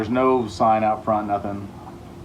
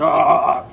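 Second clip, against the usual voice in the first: startled yelp; 4 semitones higher, 5 dB louder than usual. Retold or imitated. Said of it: "I was like".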